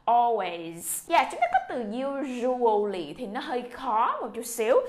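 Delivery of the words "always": "Always" ending in a buzzing z, not s; final z sound. Shown as a demonstration. Teacher shows students the z ending of "Always" slowly. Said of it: "các em phải nhớ xì Z ra giùm cô nha".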